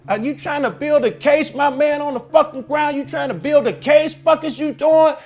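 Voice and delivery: high, loud furious outburst, retold at full force; snapped. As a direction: jump up and yell accusations at the police. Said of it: "I snapped".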